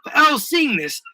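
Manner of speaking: ordinary delivery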